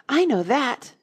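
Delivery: high, loud and keen; Alice sure she knows the answer, eager; eager cry. A narrator's reading. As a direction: burst in eagerly. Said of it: "Alice cried eagerly".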